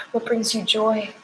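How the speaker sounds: ordinary delivery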